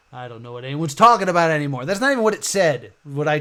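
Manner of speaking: fast and loud, suddenly fired up; excited. Real, not acted. Streamer misreads chat, excited fast loud outburst. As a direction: blurt it out, thrilled then baffled. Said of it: "got excited".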